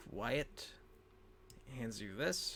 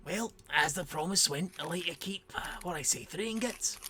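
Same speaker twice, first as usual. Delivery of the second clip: raised, lilting dwarf voice; bargaining dwarf; 5 semitones higher, 4 dB louder than usual. Acted. Female dwarf offering ingots as promised, lilting voice. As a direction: make the offer in a higher voice.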